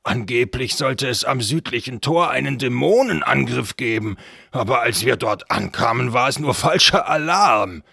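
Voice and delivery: loud put-on guard voice; defensive castle guard. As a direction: report loudly, excusing yourself to your master. (lauter Wachmann, der sich rechtfertigt)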